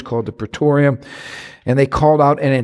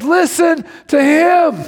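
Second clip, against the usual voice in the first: much higher, slow ringing command; commanding call; 3 dB louder, slower than usual. Acted. God telling the disciples to listen, high ringing command. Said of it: "He said".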